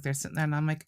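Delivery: usual speaking voice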